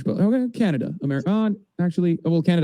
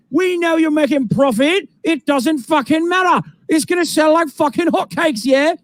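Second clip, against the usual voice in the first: shouted mock British accent; 10 semitones higher, slower than usual. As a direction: lay down the law like a British bloke. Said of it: "they're speaking in English in the U K".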